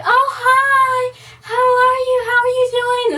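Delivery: very high, loud sing-song greeting; overly cheery hairdresser. Retold or imitated. Hairdresser welcoming a young client, overly cheery. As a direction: imitate her squeaky, over-the-top hello. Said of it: "This is legit her voice".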